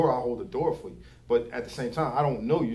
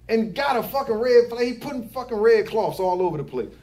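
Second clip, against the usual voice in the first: ordinary talk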